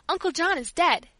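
put-on a child bursting with news; eager, high, loud announcement; excited news. Blurting out big news.

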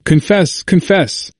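high, repeated urging in alarm; alarmed cry. A narrator's reading. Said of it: "cried they, in alarm".

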